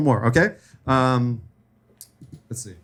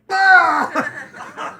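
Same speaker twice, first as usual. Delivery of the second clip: high hammed-up yell like a possessed kid; Exorcist-style retching shout; mock exorcist puke yell. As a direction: act out projectile puking with a yell. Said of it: "turned my head and was like".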